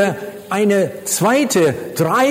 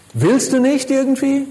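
higher-pitched incredulous question; exasperated; 8 semitones higher than usual. Retold or imitated. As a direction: press the question in disbelief. Acts out exasperated Jesus to Philip. (ungläubig nachhakend)

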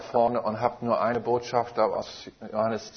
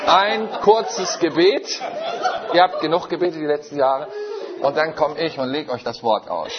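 louder and higher, laughing through it; gleeful and laughing, almost crowing; 5 semitones higher, 6 dB louder than usual. Real, not acted. Preacher telling the church it prayed enough, laughing loudly. Retells his bold announcement laughing.

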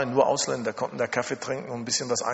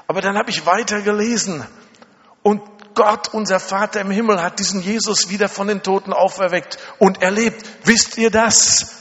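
raised, forceful voice building to a shout; fervent preaching; 5 semitones higher, 5 dB louder than usual. Retold or imitated. Chinese student preaches the risen Jesus to the missionaries, fervently preaching. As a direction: imitate her preaching with rising fire. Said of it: "Sie fing uns an, an zu predigen".

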